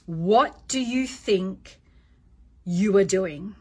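slow, measured, firm challenge. Shown as a demonstration. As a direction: pause before YOU ARE DOING, dropping the pitch. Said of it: "lower the tone of your voice".

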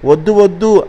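high, pleading pig cry; mild acted cry. A narrator's reading. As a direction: cry out "no" as frightened story pigs. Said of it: "అని అరిచాయి లోపల నుండి పందులు".

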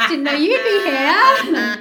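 slow, loud, high put-down; mock snide. Darcy snubs an uninvited guest, mock snide. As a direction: stretch it out with exaggerated snideness.

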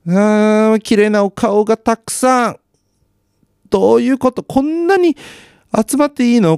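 high, drawn-out fangirl wail; gushing amazement. Real, not acted. Viewer marveling at all the beautiful faces on TV, gushing. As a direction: gush in high-pitched disbelief, stretching the vowels.

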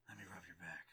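low leering whisper; creepy whisper. Acted. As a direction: breathe it like a creep. Creepy Batman flirting with Tim.

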